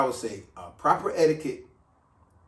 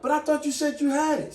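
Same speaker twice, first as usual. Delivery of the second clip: higher, indignant protest; mock protest; 11 semitones higher than usual. Imitating the friend who thought the bill was covered, pitched up. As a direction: voice the friend who feels tricked.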